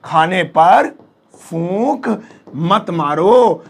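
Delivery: "खाने पर फूंक मत मारो" slowly, with pauses; teacher's slow repeat of "खाने पर फूंक मत मारो"; word by word. Shown as a demonstration. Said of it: "अब जैसे मुझे कहना".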